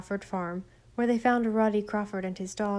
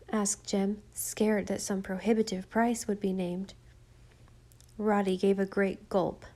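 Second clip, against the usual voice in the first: usual speaking voice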